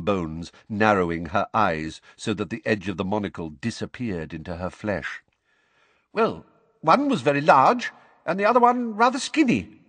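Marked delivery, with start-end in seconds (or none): 6.1-9.8 high, quavery witness voice; Missus Figg. Acted. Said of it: "in her quavery voice".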